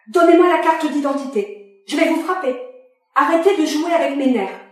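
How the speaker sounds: à bout, il menace de frapper; menace furieuse. Acted. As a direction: menace criée, plus haut et plus fort. Father threatens agent. (le père menace l'agente, fou de rage)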